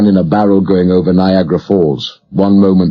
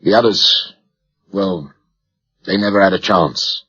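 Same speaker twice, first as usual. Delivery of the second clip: quiet, faltering admission; subdued grief; 4 dB quieter than usual. A narrator's reading. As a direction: let the voice sink as you admit it. Survivor tells of dead comrades.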